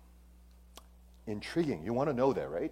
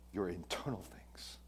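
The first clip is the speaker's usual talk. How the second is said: soft whisper, much quieter; 4 semitones lower, 12 dB quieter than usual. Real, not acted. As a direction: sink to a whisper on the inward side. Contrast outward versus inward.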